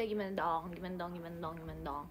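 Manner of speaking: low, quiet repeated murmur; maunya kayak gini. Shown as a demonstration. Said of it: "aku maunya kayak gini aja". (suara rendah, makin pelan)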